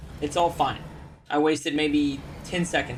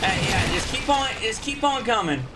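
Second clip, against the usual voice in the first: taunting enemies; 5 semitones higher than usual. Real, not acted.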